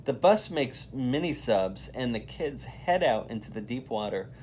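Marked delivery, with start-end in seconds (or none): none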